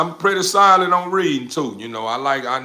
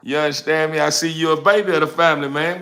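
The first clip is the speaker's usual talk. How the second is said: ordinary talk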